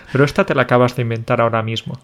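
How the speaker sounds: normal talking voice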